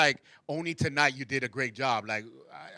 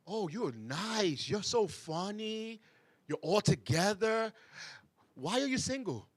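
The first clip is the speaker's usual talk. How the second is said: very slow, higher, fake-sweet praise; sugar-coat it, then slip the jab; mock sweet compliment; 5 semitones higher, slower than usual. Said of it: "here's another one that a lot of females hear".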